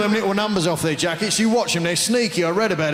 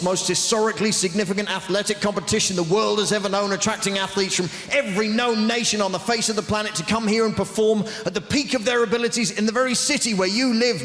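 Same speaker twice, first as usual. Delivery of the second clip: ordinary talk, nothing marked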